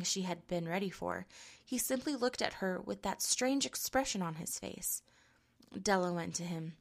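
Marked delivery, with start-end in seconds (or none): none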